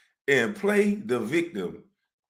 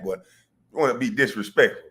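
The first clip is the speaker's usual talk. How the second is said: ordinary delivery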